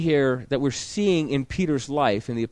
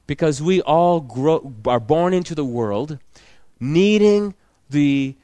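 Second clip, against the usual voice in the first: louder, slow, driving the point home; preaching at full force; 6 dB louder, slower than usual. Acted. Pastor urging his church, slow and loud. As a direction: preach it hard to the congregation.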